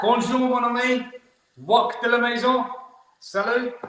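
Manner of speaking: hammed-up French host greeting viewers in France; mock French greeting. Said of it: "they don't understand the English accent".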